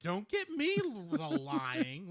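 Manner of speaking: put-on comic German accent, starting high; bad German accent. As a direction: plead in a silly, exaggerated German accent. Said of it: "we already know how my German accent goes".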